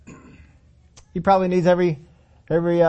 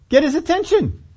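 ordinary delivery

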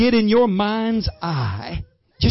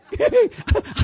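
a sudden high burst of laughter; 3 dB louder than usual. Real, not acted. Laughs at his checkbook.

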